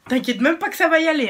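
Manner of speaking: loud, high, fast burst of eagerness; excited boast. Real, not acted. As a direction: blurt it out loudly, raring to go back. S'emballe à l'idée d'y retourner. (s'emballer bruyamment, très vite)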